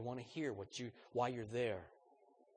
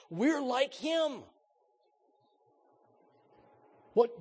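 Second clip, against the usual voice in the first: high, loud fervent preaching; preaching at full force; 10 semitones higher, 9 dB louder than usual. Real, not acted. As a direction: build the sermon to its peak.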